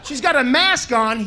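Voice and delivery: ordinary delivery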